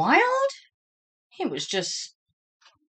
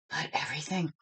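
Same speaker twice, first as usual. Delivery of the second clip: softer, unvoiced storyteller whisper; 4 semitones lower, 10 dB quieter, much faster than usual. A narrator's reading. Reading to children, whispering the suspense.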